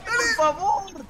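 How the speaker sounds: high, slow desperate cry; drawn-out pleading shout. Real, not acted. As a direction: stretch the plea out high. Pleading in a lost fight.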